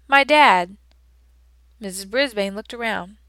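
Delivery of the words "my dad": louder blurt from the back of the class; shouted out. Acted. A boy blurts an answer to the teacher, shouting. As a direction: call it out without raising a hand. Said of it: "A voice shouted out".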